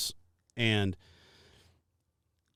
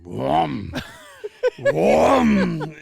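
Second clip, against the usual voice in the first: loud shouted engine blips with a laugh between; showing off to the cop by revving the engine; revving engine imitation; 8 dB louder than usual. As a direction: rev the engine with your voice, gleeful. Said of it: "I pulled up next to him going".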